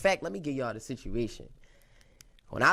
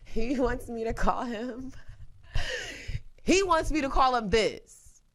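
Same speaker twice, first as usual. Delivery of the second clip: real giggles breaking in, pitched high; laughing; 11 semitones higher than usual. Real, not acted. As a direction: barely get the nickname out for laughing. Cracking up over her nickname.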